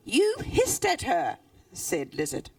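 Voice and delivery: put-on lively read-aloud voice for children; animated storytelling with character voices; story reading. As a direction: tell it like a bedtime story. Author reads her story aloud.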